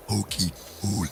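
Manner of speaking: croaky inhaled "cokey cola"; sucked-in burpy voice. Shown as a demonstration. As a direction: say it on sucked-in air, like a burp. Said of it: "I remember doing that voice".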